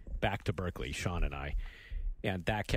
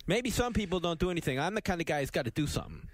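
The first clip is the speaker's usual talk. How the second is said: imitating the cabbie; 7 semitones higher, faster than usual. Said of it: "which he emphasized over and over again".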